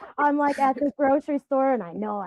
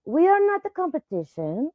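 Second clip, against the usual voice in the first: higher, emphatic imitation of a Colombian accent; partner's Colombian accent; 5 semitones higher, 4 dB louder than usual. Quoting her Colombian partner to friends, in his accent. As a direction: mimic a Colombian man's accent with conviction. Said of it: "I can't not do his accent".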